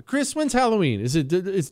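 ordinary talk, nothing marked

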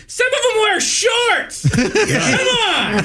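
high-pitched comic outrage; angry yelling rant. Acted. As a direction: yell it like an exasperated old comic.

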